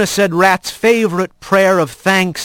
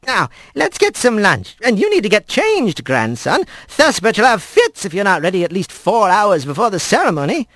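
put-on old squirrel grandmother; 6 semitones higher than usual. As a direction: coax him like a fond old granny. Grandmother orders lunch.

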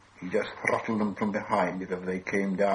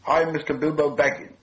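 higher-pitched, anxious hobbit; nervous introduction; 6 semitones higher, 4 dB louder than usual. A narrator's reading. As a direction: announce yourself a touch too high. Frightened hobbit introduces himself.

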